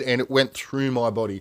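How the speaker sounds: ordinary delivery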